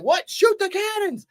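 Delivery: high-pitched incredulous echo; mock disbelief. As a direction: repeat the order as if you can't believe it. Repeats the order in disbelief.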